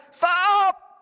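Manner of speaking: put-on sharp high shout of a startled girl; shouted stop. Big sister shouting at her little brother to stop. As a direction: yell it to halt the little brother. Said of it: "langsung berteriak".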